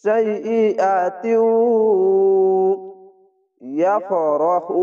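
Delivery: slow সাইয়্যাতিউ ইয়াফরাহু, a single পেশ, no তুন; recited slowly. Shown as a demonstration. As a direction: draw out the words, one vowel mark only. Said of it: "তুন না বলে ... একটি পেশ". (ধীরে একটি পেশে তেলাওয়াত)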